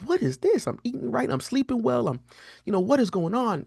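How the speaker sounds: baffled protest. Retold or imitated. Man recalling his migraines despite eating right, baffled protest.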